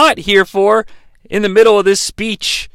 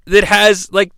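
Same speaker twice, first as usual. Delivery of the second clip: ordinary talk, nothing marked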